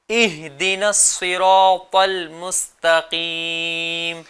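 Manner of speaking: اهدنا الصراط المستقيم drawn out very slowly, each letter articulated; تلاوت آہستہ، ہر حرف صاف; slow careful recitation. Shown as a demonstration. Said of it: "اہ، ایسے نہیں۔".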